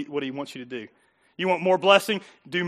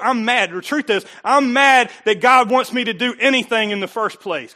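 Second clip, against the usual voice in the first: loud mock-indignant complaint; acted anger; 5 semitones higher, 8 dB louder than usual. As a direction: voice a listener's grievance, emphatically. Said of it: "angry and bitter".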